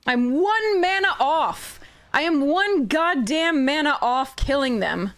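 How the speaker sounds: venting real frustration at the bad luck; frustrated rant. Real, not acted. Losing by one mana, ranting.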